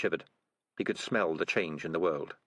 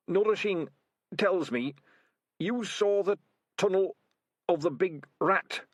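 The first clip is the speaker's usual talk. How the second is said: put-on weak old rat; 10 semitones higher than usual. Dying old rat voiced.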